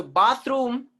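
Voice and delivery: bathroom as "BAHTH-room"; slow, high bathroom with a long ah; British pronunciation. Shown as a demonstration. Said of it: "is British sound".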